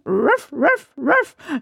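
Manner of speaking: high imitated triple woof; dog barking. A narrator's reading. Il cane risponde abbaiando. (abbaiare acuto di cane)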